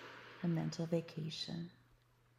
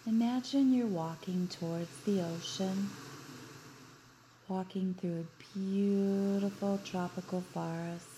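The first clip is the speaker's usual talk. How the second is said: guided meditation. Acted. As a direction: guide them gently into relaxation. Leads a guided beach visualization.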